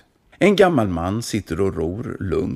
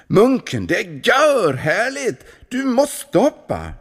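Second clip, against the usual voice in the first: high, loud thrilled call; excited urging; 14 semitones higher, 4 dB louder than usual. Acted. Kulan urging the monk to jump, excited. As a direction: gush about how great it is.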